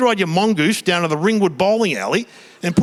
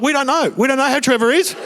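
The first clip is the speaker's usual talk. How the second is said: higher, awkward evasive answer; sheepish mum; 7 semitones higher than usual. Retold or imitated. Mum answering Uncle Barry, mildly sheepish. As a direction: dodge the question. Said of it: "Mum's like".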